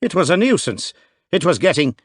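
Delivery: a rattled Irishman with his brogue coming through; deepening Irish brogue. A narrator's reading. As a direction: thicker Irish accent as he gets flustered. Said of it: "his brogue deepening in his disturbed mood".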